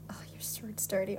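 very soft, whispered jibe; whispered mock reproach. Friend mimicking Aslan's remark to Peter, whispered mockery. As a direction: whisper it as a mocking aside.

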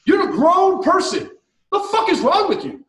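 high, exasperated rant; indignant telling-off; incredulous scolding. Real, not acted. Incredulous scolding of grown woman.

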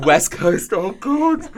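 high, loud cry of embarrassment at her own slip; a mortified squeal over getting the coast wrong; embarrassed shriek. Real, not acted. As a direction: shriek it as you catch your own blunder.

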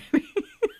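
high-pitched burst of laughter; high giggling laugh. Real, not acted. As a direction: crack up at your own bit. Cracks up at own southern voice.

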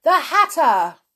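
loud, high court summons; called out. A narrator's reading. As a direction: announce the name at a high, loud pitch.

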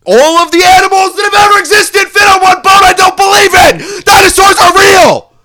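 very high, loud comic screaming; screaming rant. Acted. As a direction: scream it out, voice at the very top.

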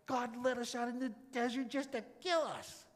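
complaining Israelites. Retold or imitated. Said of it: "the people are like".

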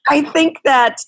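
normal talking voice

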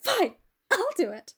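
high, exasperated mock outburst; huffy Dorian. Dorian to painter, whipping off the veil, huffy. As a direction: give in with a theatrical huff.

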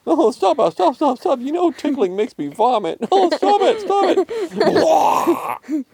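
high, loud helpless protests; squealing, panicky "stop it"; tickled cat begging. A narrator's reading. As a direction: plead through acted ticklish laughter. Tom is being tickled.

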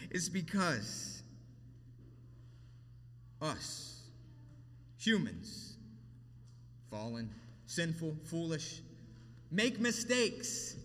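emotional. Real, not acted. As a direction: let each word fall heavy with feeling. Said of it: "this is why I'm emotional this morning".

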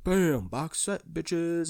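high, loud, drawn-out reveal; hyped mock announcement. Marvel springing a box set on fans, hyped mock announcement. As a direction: punch it out like a big reveal.

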